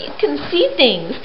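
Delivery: plain reading voice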